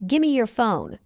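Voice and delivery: quick, linked "Gimme your phone"; fast English. Shown as a demonstration. Teacher trains learners' ears, fast. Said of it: "fast English is not difficult".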